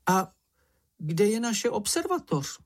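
very high, squeaky question; high-pitched inquisitive voice; Čenich, curious little creature. A narrator's reading. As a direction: ask it in a tiny high voice.

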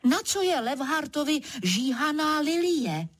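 high-pitched indignant question; mild mock shout. A narrator's reading. Narrator demanding why a leopard wants a lily, mild shout. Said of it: "rozkřiknu se zas".

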